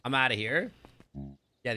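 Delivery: usual speaking voice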